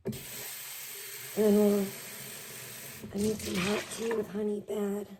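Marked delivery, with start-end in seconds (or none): none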